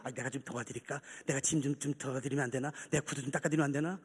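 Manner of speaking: put-on very low, hushed old man's plea; timid, low offer from an old man; meek offer of help. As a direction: murmur humbly, barely above a breath. Grandfather meekly offering odd jobs.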